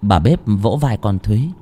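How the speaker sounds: plain reading voice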